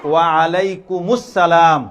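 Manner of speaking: ওয়া আলাইকুমুস সালাম slowly, long vowels held; clear, correct pronunciation. Shown as a demonstration. Teacher leads the class in answering salam, slow clear pronunciation. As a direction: lead a chorus in ওয়া আলাইকুমুস সালাম, drawn out. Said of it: "স্পষ্ট শুদ্ধ উচ্চারণে".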